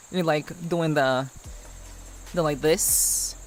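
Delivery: ordinary talk, nothing marked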